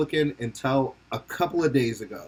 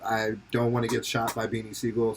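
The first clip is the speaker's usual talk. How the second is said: ordinary talk, nothing marked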